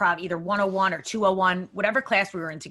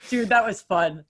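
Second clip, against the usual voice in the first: ordinary delivery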